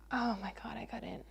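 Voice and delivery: whispered oh my God. Real, not acted. Vlogger whispers in disbelief at the story. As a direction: breathe it with no voice. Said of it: "whispered aside".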